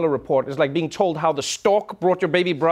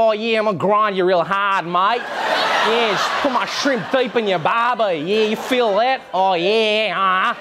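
broad mock Australian accent; aggressive Australian accent. Comedian as an Australian coming on strong, aggressive Australian accent. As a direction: lean hard into a pushy, exaggerated Australian drawl. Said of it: "Australia is much more aggressive".

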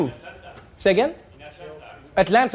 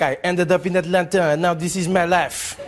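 mock TV-show voiceover in a French accent; 4 semitones lower than usual. French immigrant narrating his TV show, low mock French-accented voice. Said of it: "It's like".

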